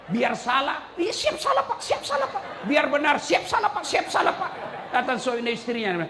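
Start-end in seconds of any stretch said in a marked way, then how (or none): 0.1-4.5 high squeaky yes-man voice, repeated yes sir; mock subordinate. Preacher mocks husband yelling yes sir at his boss.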